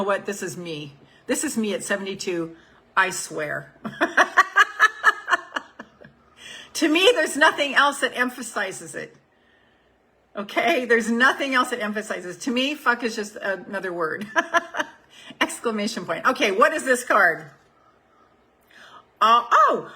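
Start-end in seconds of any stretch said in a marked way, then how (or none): 3.8-5.8 loud high laugh after swearing; high burst of laughter. Real, not acted. 12.4-16.3 laughing while talking. Real, not acted. Laughing through the remark.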